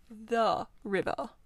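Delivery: "The river" said slowly, leaning on The; firmly correct: "The river"; a pointed correction, stressing The. Acted. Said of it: "corrected the rat".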